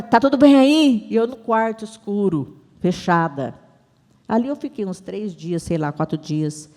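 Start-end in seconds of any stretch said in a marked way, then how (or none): 0.0-1.1 raised, higher-pitched check-in; imitated husband's loud concerned call. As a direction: call through a doorway to someone inside. Acts husband calling into dark room.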